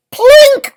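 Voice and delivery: very high, loud sharp plink; a bright sudden ping; plink sound effect. Acted. Iceberg hitting the triangle, high loud plink. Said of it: "it says".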